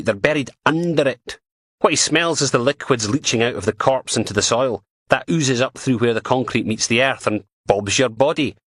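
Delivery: PC Martin voice. Acted. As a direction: voice her as a blunt, scornful dog handler. Martin explains cadaver dogs.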